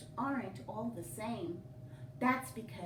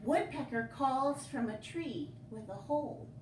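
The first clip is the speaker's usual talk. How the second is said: ordinary delivery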